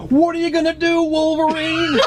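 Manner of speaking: high, hammed-up heckling voice; mocking townsfolk. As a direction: jeer like a silly villager. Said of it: "there's, like, townsfolk".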